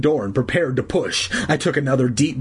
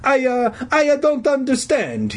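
put-on high, halting Italian accent; flustered old Italian janitor; stammering Italian voice; 13 semitones higher than usual. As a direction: stammer in confused cartoon Italian. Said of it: "he stammered".

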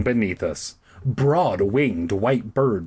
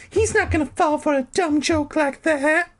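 high announcing voice. Acted. Carolyn's high voice.

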